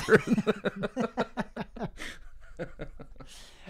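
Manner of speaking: real high-pitched laughter; long laughing fit. Real, not acted. Listener cracks up at the story.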